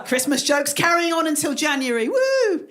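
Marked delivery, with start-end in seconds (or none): 2.1-2.7 high-pitched whoop; drawn-out high party "woo"; mock cheer.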